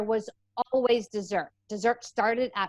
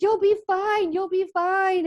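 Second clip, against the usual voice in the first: high-pitched, louder hammed-up reassurance; mock reassurance; 9 semitones higher, 4 dB louder, slower than usual. Boyfriend to girlfriend quitting sugar, mock-reassuring. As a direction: imitate the exasperated boyfriend, pitched way up. Boyfriend answering her acted meltdown.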